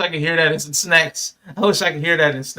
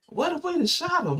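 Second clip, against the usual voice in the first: high, stunned voice of the cop; high mock cop voice, disbelieving; imitating the cop; 14 semitones higher, 4 dB quieter than usual. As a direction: play the shaken officer, pitched way up. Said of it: "the way he just looked up, like".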